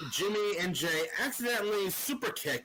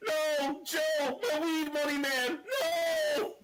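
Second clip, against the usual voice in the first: mock whine; 9 semitones higher, slower than usual. Mocking voice of Jey.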